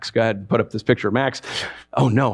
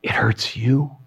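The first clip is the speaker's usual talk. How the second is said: tender plea; 6 semitones lower, slower than usual. Acted. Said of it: "Jesus pleading softly".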